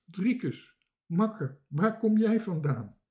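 high, loud, hearty welcome; delighted greeting. A narrator's reading. Two sailors reunite on the raft, excitedly.

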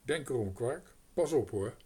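firm mock warning. A narrator's reading. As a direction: warn the crow off the mice. Girl warns the crow.

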